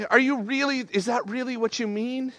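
puzzled, pleading double-check; mild disbelief. Acted. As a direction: ask it incredulously, without shouting. Reader questioning scripture in disbelief.

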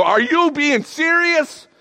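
high, slow, incredulous challenge; acted-out indignant astonishment; loud disbelief. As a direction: stretch it out loudly, aghast. Acting out reader's disbelief.

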